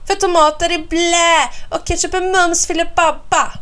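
put-on high, emphatic childish hollering; child's bellow. A narrator's reading. Snurran defends ketchup against big sister, hollering. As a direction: holler it out, punching the silly words. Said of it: "vrålar jag".

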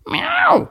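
a high, loud meow; cat meow. Acted. The cat meows a high, loud reply.

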